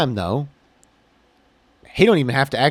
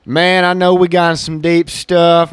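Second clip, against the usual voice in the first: slow, raised country drawl easing off; 5 semitones higher, 6 dB louder, slower than usual. Real, not acted. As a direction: let the country accent come out a little. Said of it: "I'm getting my country voice out a little bit".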